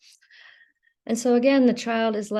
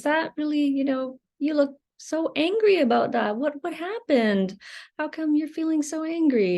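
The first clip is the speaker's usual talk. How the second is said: higher, gently curious parent; 8 semitones higher than usual. Retold or imitated. Parent kindly asking an angry child what happened, curious. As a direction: ask kindly, wanting to understand.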